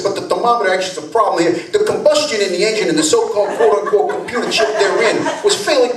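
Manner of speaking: fast-talking New Jersey wiseguy; Jersey mechanic's fast spiel; New York accent. Retold or imitated. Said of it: "Brooklyn, New Jersey".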